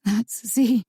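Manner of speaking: quivering doubt. Acted. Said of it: "a hint of quivering doubt".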